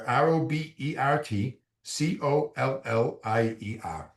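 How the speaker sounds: spells it out slowly letter by letter; each letter slow and separate; dictating slowly; slow spelling. Shown as a demonstration. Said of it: "one more time".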